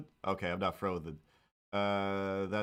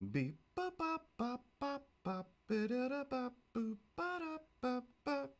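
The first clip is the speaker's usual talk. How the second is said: high beeping robot noises; robot beeps; 14 semitones higher, 6 dB quieter, slower than usual. Pretend. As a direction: beep like a little robot. Robot noises after calling chat robot.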